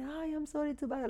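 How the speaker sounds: high, soft, quick imitation of a caller; apologetic client. Realtor voicing old clients who still call her, apologetic. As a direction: lightly mimic a sheepish phone greeting.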